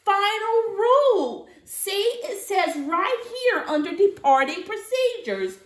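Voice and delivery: high loud fussy elf voice; Mister Pudding pleading. Acted. Mister Pudding keeps fussing.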